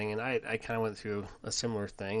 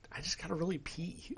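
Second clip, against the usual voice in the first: lightly raised, small daughter's voice; little girl voice; 8 semitones higher, 3 dB quieter than usual. Retold or imitated. Dad retelling his daughter's plea, in a lightly higher little girl voice. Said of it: "She finally just goes".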